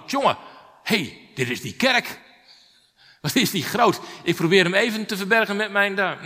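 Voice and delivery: a surprised churchgoer staring up; amazed thought. Retold or imitated. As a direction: gasp at how big it is. Amazed thought before big church.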